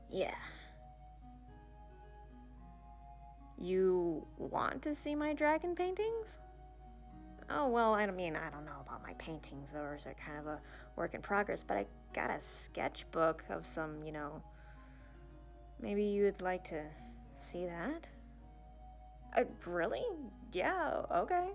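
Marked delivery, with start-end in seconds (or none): 3.6-6.4 high, slow, rising question; surprised delight. Acted. Surprised he asks to see paintings. 19.4-21.5 high, lilting and rising; eager agreement. Acted. Agrees eagerly to show sketchbook.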